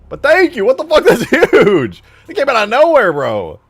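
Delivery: loud and high, laughing with real delight; thrilled laughing shout; real excited laughing thanks. Real, not acted. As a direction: still reeling, yelling thanks through laughter. Reacting to a huge donation.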